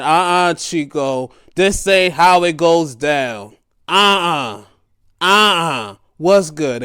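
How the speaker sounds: loud, slow, drawn-out warning; tough-guy shout. Retold or imitated. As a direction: warn him off loudly, stretching each uh-uh. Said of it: "Hobbs comes there ... and said".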